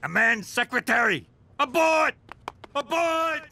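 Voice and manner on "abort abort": panicked shout. Acted. Panicked retreat call.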